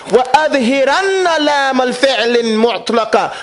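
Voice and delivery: quick chanted reading of وَأَظْهِرَنَّ لَامَ الْفِعْلِ; recited Arabic text. Shown as a demonstration. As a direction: recite the Arabic line briskly. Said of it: "it says".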